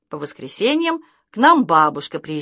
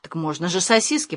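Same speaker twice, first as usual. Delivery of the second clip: usual speaking voice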